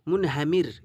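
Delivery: মুনহামির ending in a light "mir"; thin light r. Shown as a demonstration. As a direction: keep the final r of মুনহামির thin. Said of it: "রা কতটা পাতলা হচ্ছে আওয়াজটা".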